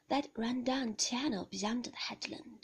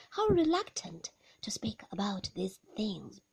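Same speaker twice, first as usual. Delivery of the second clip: ordinary delivery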